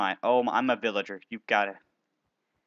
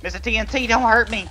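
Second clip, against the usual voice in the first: high, louder mock begging; mock pleading; 10 semitones higher, 9 dB louder than usual. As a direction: plead playfully for mercy. Begging enemy player in game.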